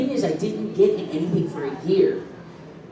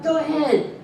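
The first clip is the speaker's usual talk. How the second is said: put-on kid voice; 11 semitones higher than usual. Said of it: "I was like".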